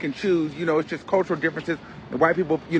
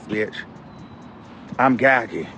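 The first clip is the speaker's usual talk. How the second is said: usual speaking voice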